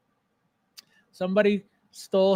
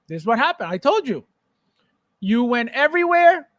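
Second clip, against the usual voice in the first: normal talking voice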